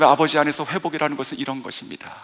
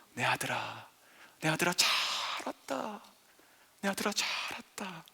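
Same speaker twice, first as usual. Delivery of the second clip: much softer, hushed loving father; whisper-soft welcome to a lost son; father softly welcomes his son home; tender father; 10 dB quieter than usual. Acted. Father embraces returning son.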